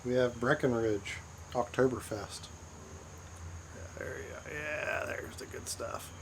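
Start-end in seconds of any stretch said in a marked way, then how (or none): 3.8-6.1 hushed, breathy aside over the beer; whispering. Real, not acted. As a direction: whisper it like a private treat. Savoring beer, whispering to camera.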